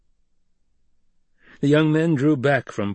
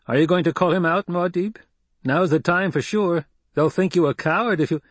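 put-on bold young warrior; 7 semitones higher than usual. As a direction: push him to fight, quick and eager. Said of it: "bolder than the others".

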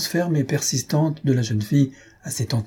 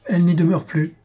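higher-pitched, lightly voiced interruption; interrupting concierge; 6 semitones higher than usual. A narrator's reading. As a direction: cut in as the concierge. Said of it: "Le concierge l'interrompit". (concierge qui coupe la parole)

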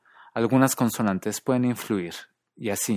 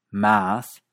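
regional American accent; 5 dB louder than usual. Shown as a demonstration. Teacher recapping five accents, American MOUTH without glide. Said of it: "en otras partes de Estados Unidos".

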